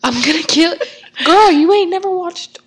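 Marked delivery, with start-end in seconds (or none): 1.2-2.6 exasperated. Real, not acted. Exasperated disbelief.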